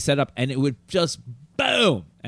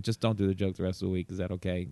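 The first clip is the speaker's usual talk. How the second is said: softer, gentle ask; quiet request; 6 semitones lower, 7 dB quieter than usual. Retold or imitated. Cruise director quietly asks the comic to drop the joke. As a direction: ask him quietly and kindly. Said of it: "He's like, comes up to me".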